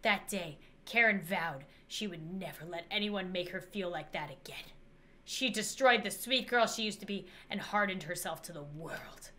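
story narration. A narrator's reading. Player narrating how Karen hardened herself. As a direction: read it like a sad backstory.

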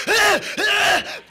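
loud, high grunting yelps; hammed-up high yelps; mock rock grunts. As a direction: grunt like a wild singer. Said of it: "He goes like, he's like".

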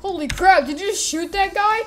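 high, incredulous cry; shocked outburst. Acted. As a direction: react loudly, in play-acted disbelief. Character reacts to a shooting.

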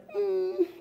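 a high, unwilling little hum; grudging high-pitched mm; reluctant hum. Retold or imitated. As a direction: hum like you really don't want to go. Timothy reluctantly accepts order.

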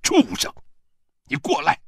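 high, furious yell; angry shout. A narrator's reading. Father-in-law orders his son-in-law over, shouting angrily. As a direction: bellow an order at someone you despise. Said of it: "对我喊道".

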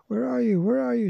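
high, searching call; yelling. A narrator's reading. Anne searching for the trapped children, high-pitched yell. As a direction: call out to someone you cannot see. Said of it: "she yelled".

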